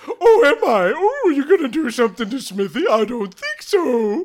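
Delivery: high, loud mocking Smithy voice; piercing theatrical taunt; taunting villain. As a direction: jeer back, stretching the last words. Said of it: "he's really antagonizing you guys".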